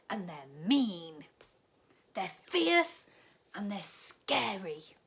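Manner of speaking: loud, dramatic scary-bear warning; family warning Katy, big and loud; stern warning. A narrator's reading. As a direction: ham up the danger for children. Family warns about bears.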